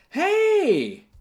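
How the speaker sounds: high, loud hey across the room; cheery call. Pretend. Calling out a greeting.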